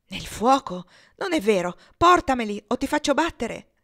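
loud, disbelieving, building to a command; outraged stern mistress; angry threat. Acted. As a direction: bark the threat, rising in pitch.

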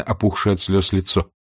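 ordinary delivery, nothing marked

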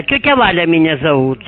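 usual speaking voice

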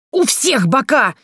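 high, drawn-out shriek; high-pitched retort; squealing. A narrator's reading. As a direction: squeal it slowly in exasperation. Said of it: "завизжала я".

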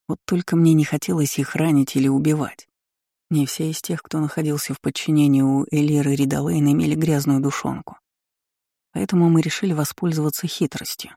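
ordinary delivery, nothing marked

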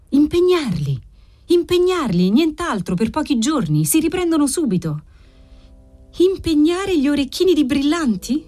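high-pitched anxious urging; hurried, anxious. A narrator's reading. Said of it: "disse lui frettolosamente e ansiosamente".

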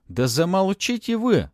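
high, drawn-out exasperated shout; shouting over noise. A narrator's reading. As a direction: yell to silence a noisy crowd. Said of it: "перекрикивая шум, крикнул Матвей".

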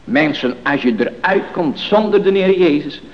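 loud, emphatic sermon delivery; forceful preaching. Real, not acted. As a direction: press each point home from the pulpit.

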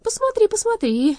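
high, loud, mock-amused; laughing taunt. A narrator's reading. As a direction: toss it off mockingly. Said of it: "рассмеялась Карина".

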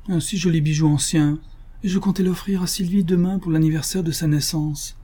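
high-pitched plaintive lament.

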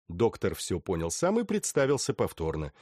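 plain reading voice

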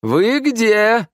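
high, loud searching call; calling out loudly. A narrator's reading. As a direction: sing out to someone in another room. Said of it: "закричал Лео". (громко, высоко кричать)

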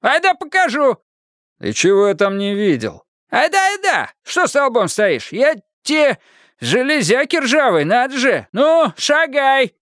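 put-on high, insistent offended old man; bossy old watchman. A narrator's reading. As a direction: drive him on like a herd. (сердитый сторож гонит парня)

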